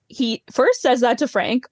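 usual speaking voice

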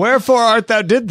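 high mock Shakespearean line; hammy stage actor. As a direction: overplay it like a stage Romeo. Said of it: "maintaining the accent".

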